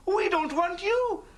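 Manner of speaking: ordinary delivery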